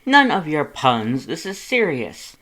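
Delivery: serious, booming big brother; stern Papyrus. A narrator's reading. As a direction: cut off the jokes firmly.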